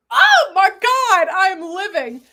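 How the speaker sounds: high, loud, drawn-out delight; thrilled outburst. Real, not acted. Loving the babushka look, loudly thrilled. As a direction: burst out loud, overjoyed.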